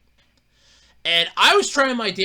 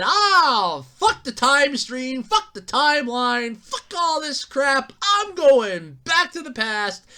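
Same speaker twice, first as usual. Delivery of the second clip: mock Steve Rogers; 7 semitones higher, 3 dB louder, slower than usual. Voicing Steve Rogers quitting.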